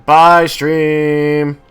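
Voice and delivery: very loud, high farewell call; shouted goodbye. Real, not acted. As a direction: holler goodbye to the whole audience.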